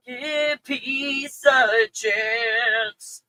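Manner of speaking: very slow, drawn-out sarcastic chant; sarcastic hippie chant; mocking slogan. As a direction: stretch the slogan out in mockery.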